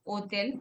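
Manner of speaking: "hotel" as o-tel, no h or s; French pronunciation. Shown as a demonstration. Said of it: "They've eliminated the S".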